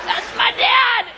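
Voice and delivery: high, loud, slow laughing cry; laughing shout. Real, not acted. Laughing at her dad's call, shouting with delight.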